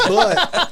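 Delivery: high unvoiced giggle into the word; high laugh. Real, not acted. Wrestler cracking up at his own kissing joke, laughing. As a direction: break up laughing.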